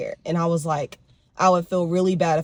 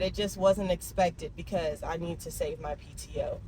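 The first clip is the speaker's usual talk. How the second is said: ordinary talk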